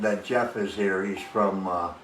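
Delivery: usual speaking voice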